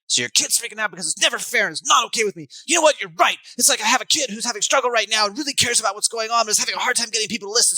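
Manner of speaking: high, loud, agitated; matching a freaking-out kid. Shown as a demonstration. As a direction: hit the kid's intensity head on. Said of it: "I hit them at their amplitude".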